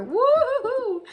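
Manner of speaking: slow, high sing-song woo-hoo; excited cheer. Real, not acted. Pleased with the perfect fit of her craft, cheering. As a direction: crow with delight.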